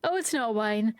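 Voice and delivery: usual speaking voice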